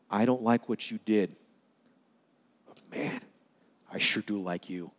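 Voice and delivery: soft, hushed loving reassurance; tender whisper. Retold or imitated. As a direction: lean in and say it gently, nearly whispered. Pastor's tender words to child.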